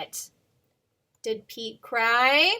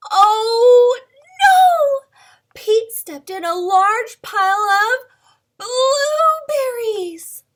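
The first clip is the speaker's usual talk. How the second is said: much higher, loud cry of alarm; 10 semitones higher, 14 dB louder, slower than usual. Acted. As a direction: wail the mishap, hammed up. Dramatic storybook mishap.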